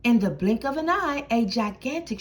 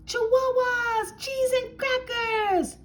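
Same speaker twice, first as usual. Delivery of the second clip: much higher, slow comic squeal; squeaky exclamation; 11 semitones higher than usual. Acted. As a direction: pipe it up high for comic effect. Quick exclamation in story.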